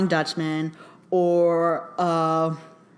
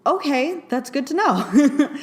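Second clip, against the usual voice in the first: laughing; 8 semitones higher, 4 dB louder, slower than usual. Retold or imitated. Dry reply to dad's opinion.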